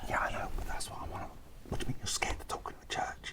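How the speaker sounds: fast heated whisper; whisper yelling. Real, not acted. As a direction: argue in a rapid whisper. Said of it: "I find it weird that we're whispering".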